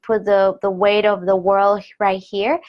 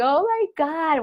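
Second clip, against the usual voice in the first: excited; 8 semitones higher than usual. Retold or imitated. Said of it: "It's like".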